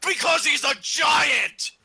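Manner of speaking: high, loud, drawn-out bellow; mock shout. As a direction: shout it like an over-the-top announcement. Mock announcement of wrestler's rampage.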